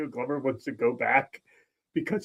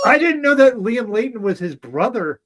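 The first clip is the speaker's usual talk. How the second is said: higher, louder surprised burst; real amazement; 7 semitones higher, 6 dB louder than usual. Real, not acted. Co-host learning two men are brothers, amazed. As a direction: exclaim it, pitched up and loud.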